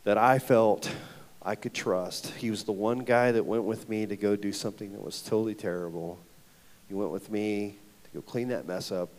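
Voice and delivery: ordinary talk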